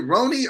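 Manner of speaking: "Roni" with a long O as in "bone"; pronunciation check. Shown as a demonstration. Asking a commenter about their name, long-O "Roni". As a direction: say "Roni" with a long oh in the first syllable. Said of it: "I need to get this right".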